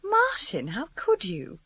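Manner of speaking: high, outraged cry of his name; protest, stung; indignant surprise. Acted. Journalist stung by his suspicion, indignant.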